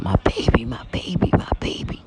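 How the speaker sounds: strained, breathy imitation of a cry; mock yell, half-whispered and straining, no real volume; retold yelling out. Churchgoer retelling her panicked screaming in church, hushed mock yell. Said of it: "yelling out".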